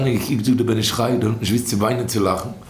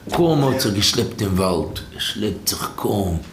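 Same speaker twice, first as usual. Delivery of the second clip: slow, low, dragged-out words; weary dragging; 4 semitones lower, slower than usual. Retold or imitated. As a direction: drag it out as if barely trudging along. Acting out a man barely dragging along.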